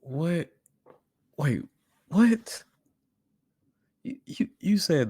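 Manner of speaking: high, incredulous what; can't believe what he just heard; disbelief. Real, not acted. Stunned by the claim.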